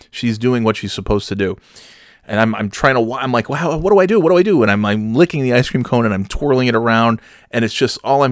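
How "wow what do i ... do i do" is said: high, flustered retold panic; comic panicked outburst; mock panic. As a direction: plead helplessly, pitch jumping up. Retelling his own panic.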